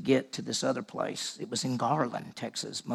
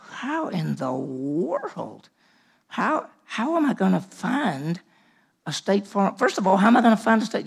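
incredulous panic; 7 semitones higher, 5 dB louder than usual. Retold or imitated. Panicked thought over huge phone book.

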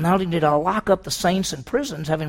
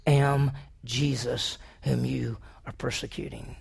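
voice of the Lord; 5 semitones lower, 7 dB quieter, slower than usual. Acted. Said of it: "the Lord said".

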